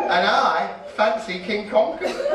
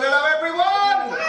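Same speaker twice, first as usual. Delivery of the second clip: bigger, higher hello to the crowd; louder greeting; 6 semitones higher than usual. Shown as a demonstration. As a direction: call it out again, bigger. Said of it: "I know you can do better than that".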